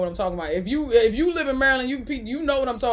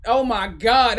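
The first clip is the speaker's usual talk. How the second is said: ordinary delivery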